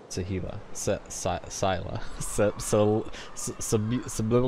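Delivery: "Sahila" broken into false starts, "Sa-, Sil-, Sab-"; stumbling over a name. Real, not acted. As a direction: fumble "Sahila" syllable by syllable, giggling. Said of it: "second time with the name".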